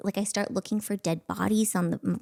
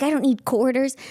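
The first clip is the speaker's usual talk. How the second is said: put-on louder, higher, indignant little girl; indignant kid; 5 semitones higher, 6 dB louder than usual. Little girl telling the crew she needs no quarters, indignant. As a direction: insist you don't need paying. Kid insisting she'd work free.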